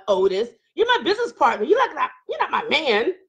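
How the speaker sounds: ordinary talk, nothing marked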